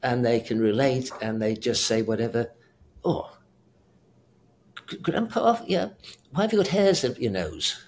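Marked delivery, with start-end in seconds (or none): 3.0-7.8 put-on high, innocent child asking grandpa, with a laugh; small child voice. Grandfather imitating his grandchild's blunt question, childlike and high. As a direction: play a curious little kid.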